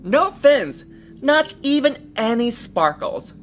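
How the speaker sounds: put-on lively read-aloud for children; storybook reading. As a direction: tell it like a bedtime story. Story narration.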